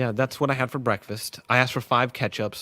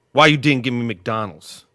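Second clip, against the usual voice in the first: Gerald Carter voice; 3 semitones higher, 11 dB louder than usual. Retold or imitated. A colleague complaining, lightly voiced and louder. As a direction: whine at him for the missing food. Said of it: "that's how he talks in my head".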